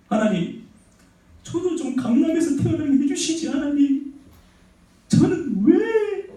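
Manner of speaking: high, drawn-out whine to God; mock resentful complaint. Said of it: "이러면서 맨날 원망하고". (하나님께 원망하듯 높고 길게 징징대며)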